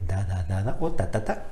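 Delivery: D syllables versus T syllables; tongued syllables. Shown as a demonstration. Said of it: "una T o una D".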